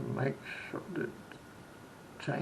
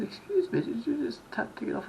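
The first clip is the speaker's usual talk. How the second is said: much higher, incredulous question; imitated puzzled local; 9 semitones higher than usual. Local questions visitor tapping off the bus, high and puzzled. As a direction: play a baffled local querying a stranger.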